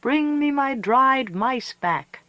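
high, slow howled plea; howling Coyote. A narrator's reading. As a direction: howl it into the night. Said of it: "cried and howled".

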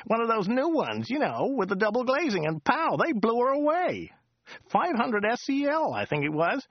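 high, chatty local driver voice; chatty driver Joe. Acted. Driver Joe chatting to Dirk on the drive, high chatty voice. Joe's voice again.

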